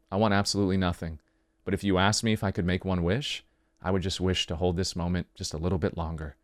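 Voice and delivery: low, soft romantic confession; tender. Acted. Lover confessing a wish to partner, tender and low.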